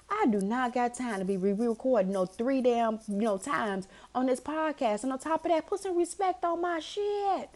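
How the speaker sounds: high-pitched scolding rant building to a loud laughing peak; worked-up retold tirade; going in on everybody. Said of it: "I'm going in on everybody".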